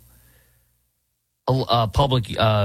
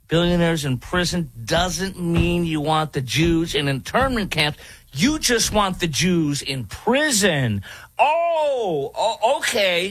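high, slow, mock-shocked sarcasm; sarcastic; 7 semitones higher, slower than usual. Real, not acted. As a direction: feign shocked understanding, dripping sarcasm. Sarcastic reaction to the clip.

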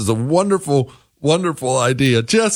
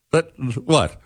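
usual speaking voice

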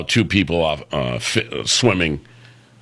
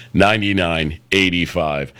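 usual speaking voice

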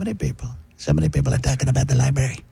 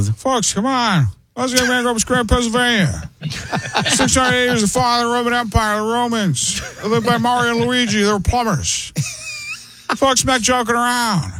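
put-on high, thin old man voice; higher aging president rambling; old Biden voice; 10 semitones higher than usual. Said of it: "almost Biden's age".

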